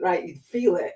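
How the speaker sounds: normal talking voice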